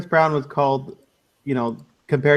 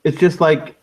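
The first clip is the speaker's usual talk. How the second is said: ordinary talk, nothing marked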